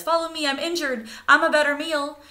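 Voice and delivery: hammed-up injured bird; pleading injured bird. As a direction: lure the fox away. Killdeer broken wing trick.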